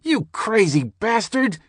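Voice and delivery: very high, slow shocked scream. A narrator's reading. Skinner screams at the narrator in the doorway, very high. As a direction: scream it at him in disbelief. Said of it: "he screamed".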